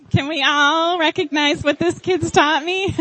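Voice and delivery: rally the room while laughing; laughing call-out. Real, not acted. Mother rallying the church to cheer her son, loud and laughing.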